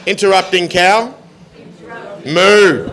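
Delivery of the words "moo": a loud, drawn-out cow's moo. Acted. Comedian cutting off the knock-knock as the cow, loud moo. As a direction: moo like the interrupting cow, loudly.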